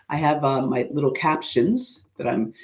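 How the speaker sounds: normal talking voice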